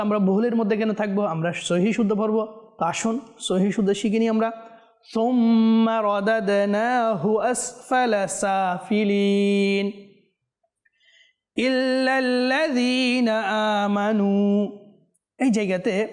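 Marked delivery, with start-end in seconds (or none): 5.1-14.7 slow, drawn-out melodic tajweed chant; Quran recitation. Shown as a demonstration. Teacher reciting a verse for viewers to follow, slow melodic chant. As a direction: chant each syllable long and measured.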